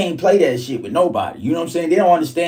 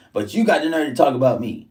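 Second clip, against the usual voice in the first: normal talking voice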